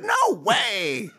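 high, drawn-out scoff of disbelief; emphatic disbelief. Retold or imitated. Man answering his ex-wife, emphatic acted disbelief.

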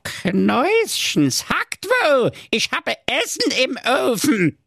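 high, drawn-out witch voice; old witch voice. Acted. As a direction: croon like a crafty witch. Witch over intercom.

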